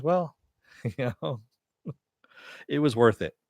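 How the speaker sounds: laughing, amused shrug. Retold or imitated. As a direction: laugh through the words. She laughs off the damage.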